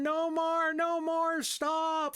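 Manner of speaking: retold roommate begging, high mock scream; mock pleading scream. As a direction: squeal like a beaten roommate begging it to stop. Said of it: "the guy's screaming".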